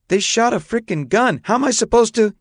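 high, exasperated outburst; upset protest. A narrator's reading. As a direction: protest that the blame is unfair. Upset boy protests the blame.

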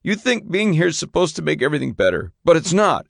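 high-pitched hurt accusation; upset teenage son. A narrator's reading. Son blaming his father for the move, upset and high.

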